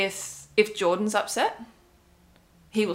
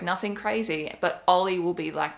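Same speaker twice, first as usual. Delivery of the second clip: ordinary talk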